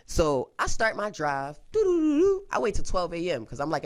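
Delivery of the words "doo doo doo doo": high, loud doo-doo trill; sing-song noise for setting off; imitated driving sound.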